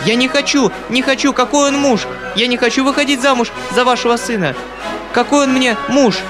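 high, panicked refusal; frightened refusal. A narrator's reading. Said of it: "закричала испуганная Татьяна Ивановна". (испуганный отказ, высоким голосом)

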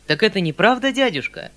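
возбуждённый высокий возглас; спроси громче и выше, едва не в восторге; почти восторг. A narrator's reading. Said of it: "вскричал я почти в восторге". (восторженное восклицание)

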